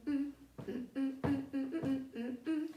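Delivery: usual speaking voice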